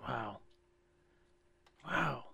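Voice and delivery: ordinary talk, nothing marked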